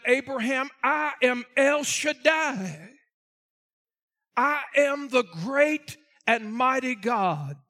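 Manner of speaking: loud, slow proclamation as God; booming out scripture to a congregation; full-force preaching. Acted. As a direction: preach it out, stretching each word. Preaches God's words at full force.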